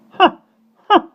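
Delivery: loud scornful ha ha; scoffing laugh. Acted. Nanny scoffing at the chick, loud scornful ha ha. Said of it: "scoffed Mary Poppins".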